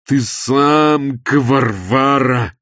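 slow, loud, high wail; drawn-out whining. A narrator's reading. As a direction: whine it out, stretching the vowels. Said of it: "тягуче заныл он". (тягуче ныть, громко и нараспев)

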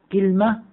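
i after k, no vowel after l in كلمة; kilma form. Shown as a demonstration. Teacher recaps three forms for class, second pronounced kilma. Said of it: "على وزن سدرة".

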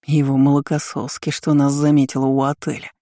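usual speaking voice

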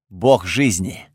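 low, slow, measured statement; weighty reveal. Acted. Готфред revealing who sits in the Артазар, weighty. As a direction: let the revelation land slowly. Solemn revelation after a pause.

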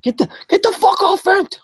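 very high mock-furious screech; angry mom impression. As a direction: imitate a raging mother, stumbling into the outburst. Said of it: "angry on Vent".

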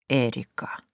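low, soft echo; savouring the name. A narrator's reading. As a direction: say Эрика low and soft, lingering on it. He lingers on her name again. (низко и тихо, смакуя имя)

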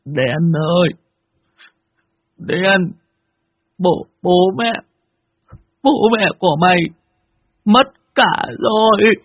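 high, halting, tearful words with long pauses; sobbing high broken-voiced bad news. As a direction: choke out the news through sobs. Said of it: "ôm lấy tay thằng cu đen mà nức nở".

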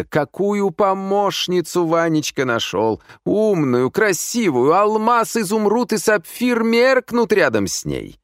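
high, lilting compliments; effusive flatterer. A narrator's reading. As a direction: lay on the courtesy thick. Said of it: "начал расшаркиваться Вилкин". (рассыпаться в любезностях)

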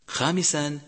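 ordinary talk, nothing marked

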